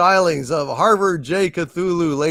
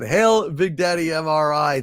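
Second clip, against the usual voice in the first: ordinary delivery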